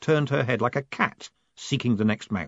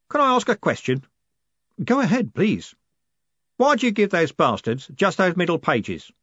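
coachman voice; 8 semitones higher than usual. A narrator's reading. Jim answering Moist, a lightly voiced coachman. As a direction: play the cheery driver.

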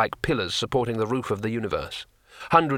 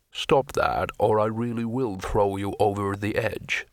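mildly snapped, in troll voice; a restrained, irritable threat; snappish troll. A narrator's reading. Troll warning the squealing wizard, mildly snappish. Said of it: "snapped the troll".

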